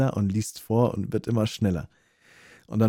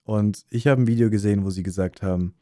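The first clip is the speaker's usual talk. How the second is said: usual speaking voice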